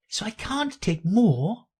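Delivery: high, huffy comeback; offended. A narrator's reading. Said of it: "in an offended tone".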